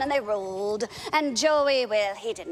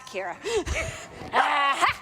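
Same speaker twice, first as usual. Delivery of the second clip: ordinary talk, nothing marked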